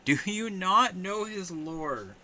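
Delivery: high, slow theatrical question; hammed-up disbelief; slow, high mock-shocked tease; mock dramatic. Teasing chat dramatically.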